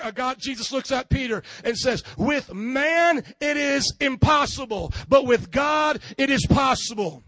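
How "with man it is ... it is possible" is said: solemn loud proclamation. Acted. Jesus answering Peter emphatically.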